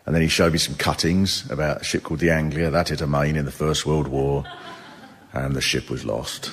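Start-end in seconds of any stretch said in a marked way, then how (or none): 5.3-6.5 mock solemn refrain.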